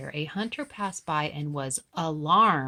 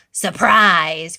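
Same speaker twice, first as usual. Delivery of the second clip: loud gleeful shout; cried out; 14 dB louder than usual. A narrator's reading. Wolf bursting in on Granny, loud shout. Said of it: "cried the wolf".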